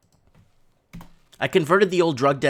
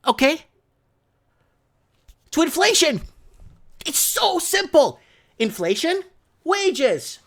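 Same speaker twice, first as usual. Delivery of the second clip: much higher, insistent, hammering each point; heated rant; 12 semitones higher, 3 dB louder than usual. Real, not acted. Host ranting to viewers about wages, heated and high. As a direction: vent the frustration, building to a peak. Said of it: "sorry about the rant".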